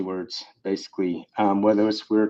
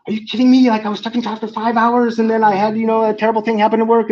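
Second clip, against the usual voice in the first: higher and fast, agitated rant; worked-up venting; 8 semitones higher, 3 dB louder, faster than usual. Acted. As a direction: vent like after a five-hour ordeal. Friend venting after a terrible day.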